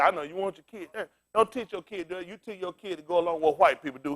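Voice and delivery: ordinary delivery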